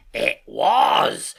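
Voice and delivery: loud, drawn-out sailor's cry; Silver, excited. A narrator's reading. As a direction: seize on it with excitement. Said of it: "cried Silver, now quite excited".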